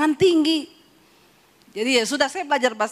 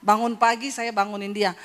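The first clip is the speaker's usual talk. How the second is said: ordinary talk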